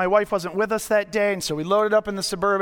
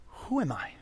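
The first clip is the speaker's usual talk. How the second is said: much lower, softer inner voice; hushed self-question; 9 semitones lower, 12 dB quieter than usual. Pretend. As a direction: ask yourself under your breath. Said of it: "and say".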